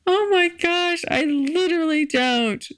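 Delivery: laughing disbelief. Real, not acted. To podcast listeners, failing to recall a guy, laughing high. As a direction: giggle at her own blank memory. Can't remember a guy at all.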